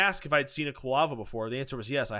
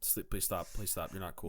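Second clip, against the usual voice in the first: softer, lower, pained begging; pained pleading; 10 semitones lower, 12 dB quieter than usual. Pretend. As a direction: quietly beg him to stop.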